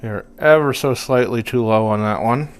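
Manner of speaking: talking with a pick in mouth; mouth full. Real, not acted. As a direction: speak around a guitar pick held in teeth. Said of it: "I'm speaking with a pick in my mouth here".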